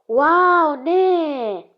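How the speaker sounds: high, loud, drawn-out mock cheer; acted cheering. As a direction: cheer like a thrilled crowd of kids. Children cheer in a joke. (δήθεν ενθουσιασμένη ζητωκραυγή)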